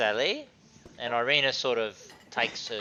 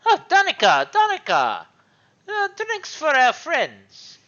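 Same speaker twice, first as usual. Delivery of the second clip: calling for drinks; 14 dB louder than usual. Acted. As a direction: lord calls out higher and louder for his servant. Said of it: "and he goes".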